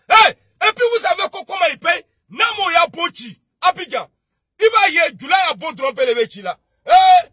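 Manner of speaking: loud, high threatening yells; furious shout. Real, not acted. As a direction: bellow it at the enemies. Threatening rant on stream. (cris menaçants, très forts)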